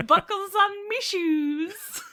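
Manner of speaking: slow, high Irish leprechaun voice, laughing; drawn-out leprechaun brogue through laughter; Irish leprechaun accent. Pretend. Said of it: "putting on the Irish".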